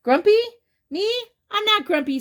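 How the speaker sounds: high, loud Grumpy? Me? then protest; indignant surprise. Acted. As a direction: act shocked, squeaky. Jim denies to Snake.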